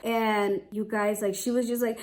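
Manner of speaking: ordinary delivery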